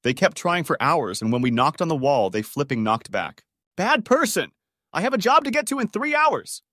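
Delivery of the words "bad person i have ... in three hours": high-pitched yell, far above usual pitch; exasperated yell. A narrator's reading. Sleepless neighbor to couple keeping them up, exasperated high yell. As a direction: yell it high, as if through a wall. Shouting through wall at noisy neighbors.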